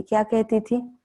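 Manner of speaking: ordinary talk, nothing marked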